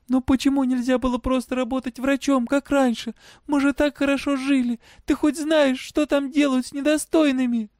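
high-pitched, agitated reproach; upset girl. A narrator's reading. Girl reproaching her father for his work, upset. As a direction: plead and accuse in a high voice.

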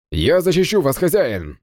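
high, devoted knight's vow; loyal knight. Acted. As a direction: swear to protect your master. Death knight shields master.

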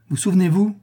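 high, rising question; high questioning rise. A narrator's reading. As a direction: ask it with a sharp high lift. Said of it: "Reprit Rodolphe avec un calme effrayant".

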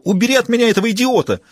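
frantic yell. Acted. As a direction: get him away from me. Reporter screaming for help.

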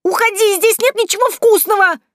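very high, loud shriek; frantic high-pitched shooing; panicked yelling. A narrator's reading. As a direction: scream to drive a beast away. Vaska yells at the supposed bear.

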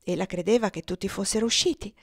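ordinary delivery, nothing marked

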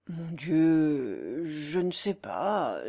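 low, slow, drawn-out reluctance; stall, stretching the words low; evasive hesitation. A narrator's reading. Countess dodges question about her son, hesitant.

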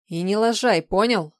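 loud, pointed order; shouted warning. A narrator's reading. Woman warning her helper not to slip up, loud. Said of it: "крикнула тень".

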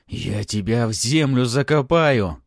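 loud, drawn-out menace; threatening. A narrator's reading. Baron pursues the boy, shouting a threat. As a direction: bellow a slow threat. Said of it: "угрожал барон".

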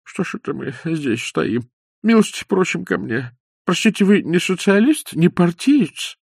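high, slowing anxious questions; old man inviting Bender in, anxious; fussy old man. A narrator's reading. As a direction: fuss over the guest nervously. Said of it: "заволновался старик".

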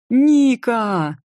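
high, loud happy yell; joyful shout. A narrator's reading. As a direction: shout her name with delight. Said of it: "радостно заорал Денис".

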